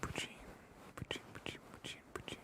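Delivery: "Pujin" repeated softly, with a ch; whispered word play; savoring a word. Shown as a demonstration. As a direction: roll "Pujin" around, whispering. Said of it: "The way that that sounds".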